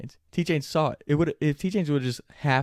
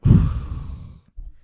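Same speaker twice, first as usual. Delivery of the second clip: big, loud acted exhale; sigh of relief; 9 dB louder than usual. Driver hears police will give only a warning, sigh of relief. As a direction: blow out all the tension at once.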